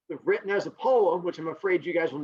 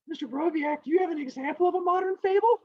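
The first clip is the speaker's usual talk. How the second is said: high, quick pupil voice; student's question; 11 semitones higher than usual. Pretend. Teacher mimics a student asking for an example, high pupil voice. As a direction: pipe up like an eager pupil.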